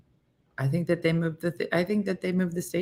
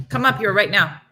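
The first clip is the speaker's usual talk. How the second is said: yelling across the room; 2 semitones higher, 7 dB louder than usual. Real, not acted. Calling dog.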